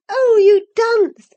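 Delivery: loud, high, slow scornful jeer; mocking laugh. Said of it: "laughing heartily at his failure".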